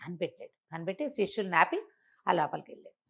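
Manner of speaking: plain reading voice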